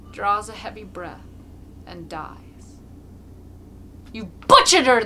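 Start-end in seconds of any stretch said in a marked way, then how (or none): 4.1-5.0 loud, angry outcry; accusing shout. A narrator's reading. The crowd shouting at Mokolka over the dead mare. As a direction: hurl the accusation. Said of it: "they shout from the crowd".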